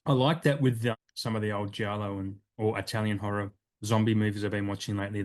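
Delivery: ordinary talk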